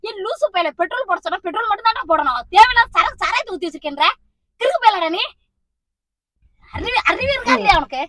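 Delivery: loud, heated scolding; angry berating. Acted. Mother berating son for putting liquor in the car, furious. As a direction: tear into him for his stupidity.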